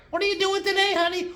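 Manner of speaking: very high falsetto wife voice; high-pitched parody of a wife; mock wife. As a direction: ask sweetly in squeaky falsetto. Plays the wife replying.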